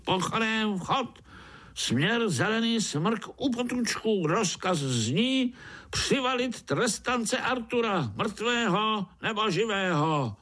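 high-pitched military order; commanding overseer. A narrator's reading. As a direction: proclaim the order in a high drill voice. Overseer orders his ant troop.

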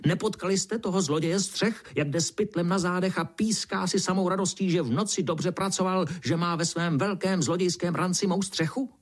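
high-pitched, ranting indignation; worked-up squawky fury; raging beetle. A narrator's reading. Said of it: "černý brouk se vztekal dál".

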